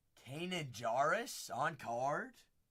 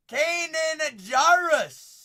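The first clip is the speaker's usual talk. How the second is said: drawn-out, loud, high name call; hyped callout; 11 semitones higher, 14 dB louder, much slower than usual. Acted. Opening a big card for Irish, a slow loud hyped callout. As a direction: belt the name like an announcer.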